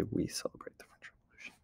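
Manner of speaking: quick whispered aside. Real, not acted. Teacher mouthing the sample answer while typing, whispered. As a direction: rush it out in a whisper.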